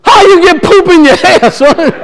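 high, laughing, tender scolding of a son; a fond father marvelling at the mess; amused father. Retold or imitated. Yeshua holding up [name], amused and gentle. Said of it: "Yeshua held me up".

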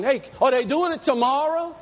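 high, anxious acted-out phone query; mock desperate plea. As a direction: plead for a second chance, hammed up. Acts out frantic phone calling afterwards.

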